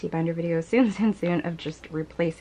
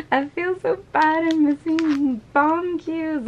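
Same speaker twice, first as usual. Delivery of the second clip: mock wail; 9 semitones higher, 4 dB louder, slower than usual. Mock despair over missing card.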